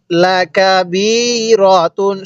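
ওয়াসসালাতি drawn out slowly and articulated clearly; careful recitation. Shown as a demonstration. Teacher teaching the word, slow careful recitation. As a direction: model ওয়াসসালাতি slowly for the class. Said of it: "এরকম ভাবে পড়ব".

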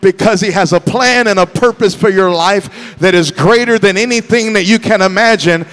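fired-up, shouted exhortation; full-force preaching. Real, not acted. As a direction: preach it out to rouse the congregation.

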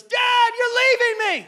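put-on very high, slow panicked child cry; son screaming. Imitating his young son screaming on a hike. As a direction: wail like a scared little boy. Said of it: "He was screaming".

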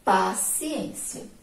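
Paciência spelled out slowly, stressed on ên; slow model reading. Shown as a demonstration. Teacher to pupils, showing the stressed syllable slowly. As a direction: stretch Paciência so pupils hear the strong syllable. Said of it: "vamos fazer a leitura".

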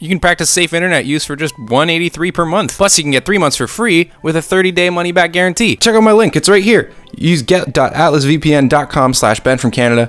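loud, fast, pumped-up sponsor pitch; excited ad read. Acted. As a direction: sell it like a hyped YouTuber.

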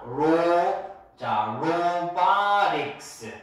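로바릭스 drawn out syllable by syllable; pronunciation demo. Shown as a demonstration. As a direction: model 로바릭스 slowly with long vowels. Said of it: "발음이 이제 잘 들어봐". ('로바릭스'를 길게 늘여 천천히 시범 발음)

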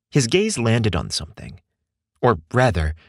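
ordinary delivery, nothing marked

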